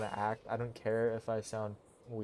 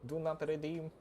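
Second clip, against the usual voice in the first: put-on playful Indian accent, raised pitch, softer; mock Indian accent; 4 semitones higher, 4 dB quieter than usual. Streamer repeating the funny line to himself, mock Indian accent. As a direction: do a goofy Indian-accented quote. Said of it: "I just do an Indian accent".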